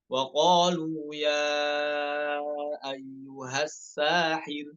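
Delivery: slow chant; Quranic recitation. Shown as a demonstration. Teacher reciting the verse slowly. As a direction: draw the verse out. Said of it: "Nomor tujuh puluh satu".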